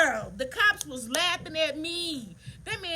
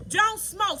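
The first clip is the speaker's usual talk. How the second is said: higher and louder, declaring it; loud emphatic repeat; 8 semitones higher, 7 dB louder than usual. Retold or imitated. Retelling the spirit's warning to viewers, loud and emphatic. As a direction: proclaim the warning like a testimony.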